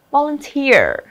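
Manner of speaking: "Volunteer" as vo-lun-TEER; stress on last syllable. Shown as a demonstration. Teacher showing which syllable carries stress, vo-lun-TEER. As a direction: lean on the final TEER of "Volunteer". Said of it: "âm thứ cuối cùng".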